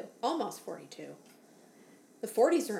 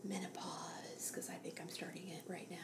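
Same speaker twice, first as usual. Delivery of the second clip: whispered; 4 semitones lower, 14 dB quieter than usual. Pretend. Joking whispered aside.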